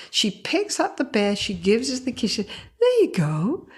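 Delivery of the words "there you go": slow, lilting reassurance; soothing mother. Retold or imitated. Mother gives her kid the lost bear, soothing. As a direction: hand it over with a tender lilt. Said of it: "But listen to her voice".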